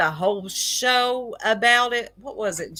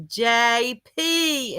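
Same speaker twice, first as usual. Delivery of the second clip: quivering voice; 4 semitones higher than usual. Retold or imitated. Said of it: "in a quivering voice".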